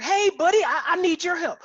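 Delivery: high, eager Texan impression; out-there Texas energy. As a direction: call out as an over-energetic Texan. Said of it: "the energy to his conversation was just".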